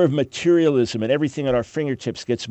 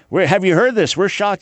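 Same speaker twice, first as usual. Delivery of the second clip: mock shock; 6 semitones higher, 4 dB louder than usual. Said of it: "Sometimes a week, a month, a year later".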